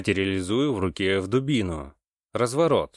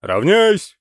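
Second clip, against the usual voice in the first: high, loud parade-ground command; barked command; 12 semitones higher, 10 dB louder than usual. A narrator's reading. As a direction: shout it like a drill captain. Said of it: "скомандовал … капитан".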